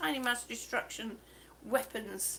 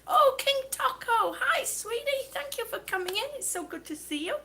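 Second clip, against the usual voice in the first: sweet greeting; 8 semitones higher than usual. Real, not acted. Thanking a viewer sweetly.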